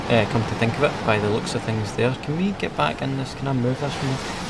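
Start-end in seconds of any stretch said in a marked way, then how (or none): none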